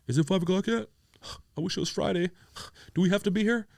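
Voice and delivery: mock a lazy clock-watcher, sighing; whiny lazy coworker. Mocking lazy corporate coworkers.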